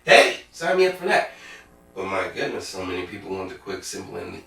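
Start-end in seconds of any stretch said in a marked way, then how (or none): 0.0-1.3 bright, louder sign-me-up; eager and louder. Real, not acted. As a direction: jump at the offer. Excited aside about the offer.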